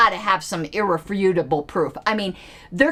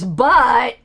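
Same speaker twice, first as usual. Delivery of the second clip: punched, louder BUT; mock emphatic but; 6 dB louder than usual. Critic parodying the tweet's wording, mockingly emphatic. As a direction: land heavily on but as parody.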